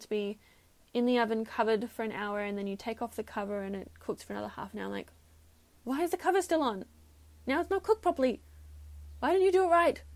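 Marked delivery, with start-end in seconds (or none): 5.8-10.0 much higher, building to a loud exasperated outburst; frustrated scolding. Retold or imitated. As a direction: scold him, more and more fed up. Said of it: "I'm getting so frustrated".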